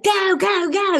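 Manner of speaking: high, eager cheer of go go go; cheering mom. Retold or imitated. Deb urging siblings to race, high cheering. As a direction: egg the kids on in a squeaky cheer.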